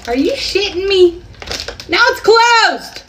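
shocked the gadget actually sealed her chips; stunned disbelief. Real, not acted. Chip bag sealer actually works.

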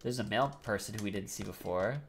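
ordinary talk

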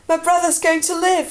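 high, elated outburst; joyful announcement. A narrator's reading. Jon telling the guards his brother will survive, elated and high. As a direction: burst out with great news. Jon runs down with good news.

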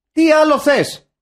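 high, loud exasperated repeat; exasperated shout. Acted. Host repeating his question for effect, exasperated shout.

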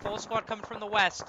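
ordinary talk, nothing marked

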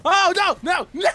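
high, loud cry of alarm; playful panicked shout. Pretend. Player caught while hiding, playful panicked shout.

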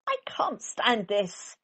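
slow and exasperated; slow, fed-up complaint. Acted. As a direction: drag it out, fed up. Mister Bear fed up.

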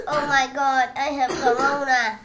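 high, very loud fake alarm; hammed-up panicked cry; mock sick panic. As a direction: wail in pretend horror at being sick. Pretends to be sick with corona.

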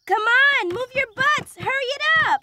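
high, urgent hurry-up call; bossy, thrilled urging; excited rallying shout. Acted.

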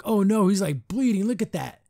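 high, animated dismay; retold alarm. Seeing Flapjack bleeding, retold high alarm.

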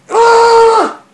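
startled so badly he shrieks out loud; scream of fright. Real, not acted. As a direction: let out a sudden, piercing scream. Sudden scare while filming.

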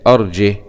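أرجه without hamza, ending "jih"; haa with sukun. Shown as a demonstration. Teacher showing the next reading, أرجه closed on silent-vowel "h". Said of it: "وسكون الهاء".

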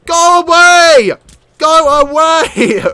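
high, loud, slow drawn-out yell; shouted dismissal. Real, not acted. Player to pirates at their meal, shouted dismissal. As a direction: stretch each word as you yell them off.

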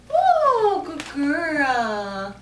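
normal talking voice

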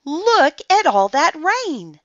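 high, loud and drawn out; excited exclamation. A narrator's reading. Olivia to guests about the storm, excited exclamation. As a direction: marvel loudly at the storm. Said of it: "exclaimed Olivia".

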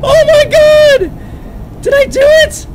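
high-pitched shrieks and laughing disbelief; shriek, then laugh it out; shrieking with excitement. Real, not acted. Sudden win in horror game.

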